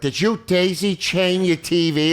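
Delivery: high, slow imitation of a questioner; imagined accuser. Police asking about the daisy-chained TV, mock interrogation. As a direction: put on a drawn-out, high questioning voice. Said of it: "they'll be like".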